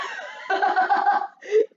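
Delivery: high loud burst of laughter; loud howling laugh. Acted. Mac bursts out laughing at the thieves. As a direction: howl with laughter. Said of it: "the laughter beginning again".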